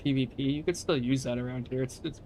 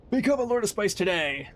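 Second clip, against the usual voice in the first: ad read; 7 semitones higher, 4 dB louder than usual. Acted. Reading out guild recruitment ad.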